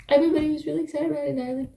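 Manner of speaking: ordinary talk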